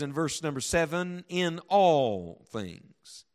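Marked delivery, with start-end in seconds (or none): 1.3-3.3 "all" stretched out long; drawn-out emphasis on "all"; stress on ALL. Shown as a demonstration. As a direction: lean hard and slowly on "all". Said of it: "I'll emphasize it as I read it".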